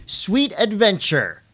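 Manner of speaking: slow drawn-out Sweet Adventure; announcer voice. Acted. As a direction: stretch the title like a ring announcer. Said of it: "announcer voice".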